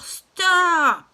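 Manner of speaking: a sudden piercing high shout; loud scream. Acted. Tia yelling at the man lifting her: loud high shout. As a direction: scream it to make him drop you. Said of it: "Tia crie très, très fort".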